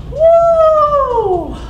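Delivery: high, loud woo; joyful whoop. Real, not acted. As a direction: cheer at the end of the set. Cheer after the last set.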